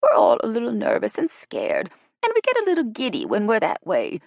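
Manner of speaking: high, loud explaining; placating excuse. Acted. Ella excusing the giddy group to the guide, high-pitched.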